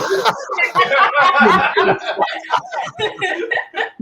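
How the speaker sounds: big high laugh; high burst of laughter. Real, not acted. Remembering the snub, laughing hard at it. Laughing at his own story.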